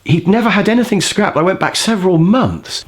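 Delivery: ordinary talk, nothing marked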